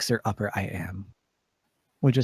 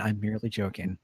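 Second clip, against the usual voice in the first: softer reassurance; 4 semitones lower, 6 dB quieter, faster than usual. Acted. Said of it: "I laugh".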